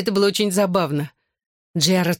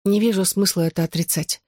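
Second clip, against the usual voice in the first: ordinary delivery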